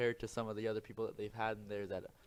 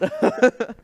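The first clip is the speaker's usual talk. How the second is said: loud laugh; 19 dB louder than usual. Real, not acted. Big burst of laughter.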